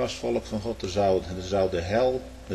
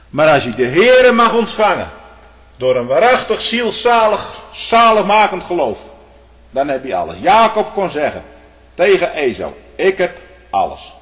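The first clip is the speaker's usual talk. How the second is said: higher, louder ringing pulpit voice; preaching at full force; 6 semitones higher, 9 dB louder than usual. Acted. Preacher to his flock about receiving the Lord, fervent. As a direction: lift it up and proclaim.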